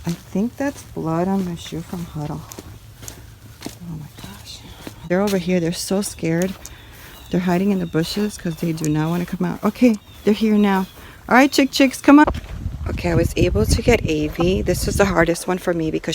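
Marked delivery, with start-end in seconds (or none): 3.7-4.6 breathless hushed gasp of alarm; shocked whisper. Real, not acted. Finding blood on her shoe, whispering in shock. 11.3-12.2 higher, sing-song call to the chicks; coaxing call. Real, not acted. Calling her frightened hens over, coaxing.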